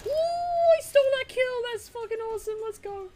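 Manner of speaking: high, loud gleeful yell; excited yell. Real, not acted. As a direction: shout it out in triumph. Excited shout over a stolen kill.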